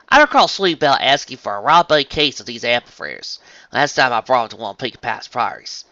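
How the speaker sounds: southern farm pony. Acted. Farmer brings apple fritters to her friend, southern twang.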